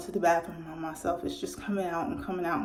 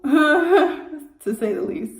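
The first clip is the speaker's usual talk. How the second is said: breaks into a high laugh on the words; amused laughing aside; 7 semitones higher, 10 dB louder, much slower than usual. Real, not acted. Laughing aside about cold room.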